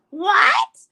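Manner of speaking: high, loud laughing "What?"; laughing disbelief. Real, not acted. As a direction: crack up in disbelief. Laughing outrage.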